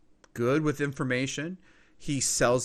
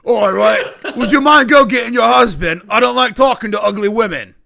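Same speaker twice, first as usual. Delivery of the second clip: much louder, higher, rude informant voice; loud insult; 9 semitones higher, 15 dB louder than usual. Acted. Informant dismissing an ugly customer, loudly scornful. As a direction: belt out the insult in character.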